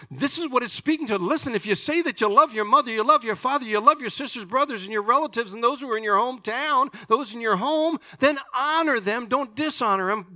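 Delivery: high, loud, insistent exhortation; full-force preaching. Real, not acted. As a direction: drive each phrase home to the congregation.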